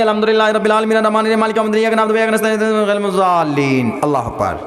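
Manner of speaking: Fatiha gabbled very fast, words swallowed; rushed taraweeh recitation. Shown as a demonstration. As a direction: race through it like a hurried hafiz. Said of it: "تراویح کی طرح نہیں". (تیز رفتار بے ترتیب تلاوت)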